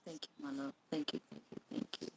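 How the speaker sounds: breathy whispered thanks; barely voiced, moved thank you; whispering. Real, not acted. Touched, thanking friend softly.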